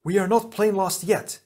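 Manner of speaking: high, defiant cry; cried out. A narrator's reading. Lewa leaps into action, defiant cry. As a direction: cry it out to rally the others. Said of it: "he cried".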